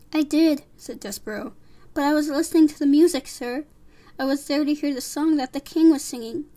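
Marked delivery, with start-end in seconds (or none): 0.1-0.6 higher, louder small-character voice; young accused mouse. Acted. Despereaux answers his judge. 1.9-6.5 higher, louder, eager explanation; small earnest mouse. Acted. Despereaux defends himself.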